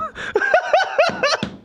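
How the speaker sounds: high-pitched laugh; long high laughter. Real, not acted. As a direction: crack up after your own joke. Laughs at own question.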